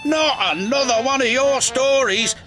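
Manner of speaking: high-pitched groaning protest; high, dismayed grump; exasperated cry. A narrator's reading. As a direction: cry out, fed up with the tales. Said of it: "cried Cranky".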